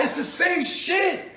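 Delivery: high, slow, laughing yell; laughing shout. Acted. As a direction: laugh it out in triumph.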